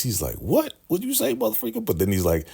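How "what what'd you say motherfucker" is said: very high-pitched incredulous squeak; mock shocked disbelief. George reacting to Daikon's invitation, mock shocked. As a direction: squeal it in comic outrage. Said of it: "look at George's face".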